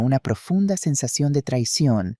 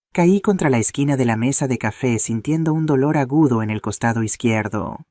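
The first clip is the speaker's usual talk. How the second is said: ordinary delivery, nothing marked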